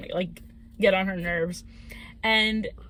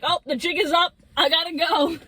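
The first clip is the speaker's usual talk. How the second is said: lightly voiced spirit, higher-pitched; mock panicked spirit; 6 semitones higher, 3 dB louder than usual. Storyteller as possessor fleeing friend's body, mock alarm. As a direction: play-act a caught demon bailing out.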